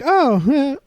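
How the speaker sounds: a high, startled little "oh"; mock surprise. A girl waking to a wrecked room, surprised. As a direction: caught off guard, voice jumping up. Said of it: "she looks kinda surprised".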